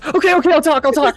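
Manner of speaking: high, loud, frantic surrender; panicked giving in. Acted. Cultist caves under intimidation.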